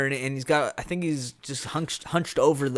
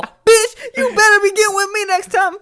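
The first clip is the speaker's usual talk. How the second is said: high-pitched, very loud comic outburst; hammed-up mock yell; 11 dB louder than usual. Friend parodying Jack after the railing scene, hammed-up high yell. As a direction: squeal it out as an exaggerated threat. Hammed-up retold movie line.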